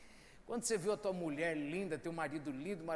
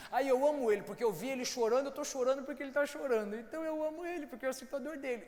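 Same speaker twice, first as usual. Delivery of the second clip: lightly voiced naive lover, higher; mock naive believer; 5 semitones higher than usual. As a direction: mimic a sentimental believer. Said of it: "se você acha o seguinte". (imitando um crente ingênuo, mais agudo)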